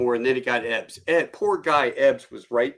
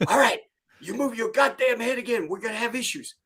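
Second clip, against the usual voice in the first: high-pitched drill instructor threat, easing in volume; mock sergeant warning; 10 semitones higher than usual. Acted sergeant warns the recruit.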